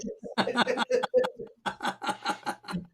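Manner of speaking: high, breathy wheezing laugh; helpless airy laughter; long laugh. Real, not acted. Amused after the viewer plug.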